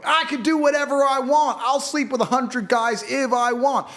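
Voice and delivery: high, loud mocking whine of a know-it-all; mocking idiots. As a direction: sneer it like a spoiled brat. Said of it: "these five percent of idiots you see going".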